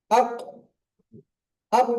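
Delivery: আক with a bouncing echo on the final ক; আক with the stop released hard; qalqala demo. Shown as a demonstration. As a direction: clip আক and let the ক bounce out. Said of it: "mute অবস্থায় বলেন আক".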